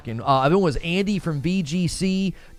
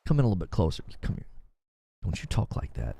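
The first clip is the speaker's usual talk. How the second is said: low, soft and close, mock menacing; a mock-serious hushed threat to chat; hushed warning; 10 semitones lower, 6 dB quieter, faster than usual. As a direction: pull them in and warn them quietly. Warning chat not to wish Marauder.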